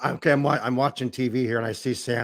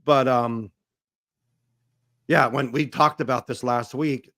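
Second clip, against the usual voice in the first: ordinary talk, nothing marked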